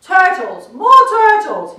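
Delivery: loud high bellow. A narrator's reading. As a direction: bray it at the top of the lungs. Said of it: "he bellowed and brayed".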